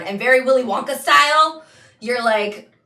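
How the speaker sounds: loud, high, rushing delivery; excited ride narration. Acted. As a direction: hurtle through it like a runaway ride. Acting out a wild boat ride.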